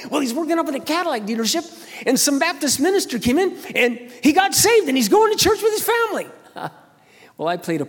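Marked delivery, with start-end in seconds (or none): 0.1-6.3 high, loud, giddy report; excited news. Retold or imitated. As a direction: rush the news out, higher. Friend giddy with good news.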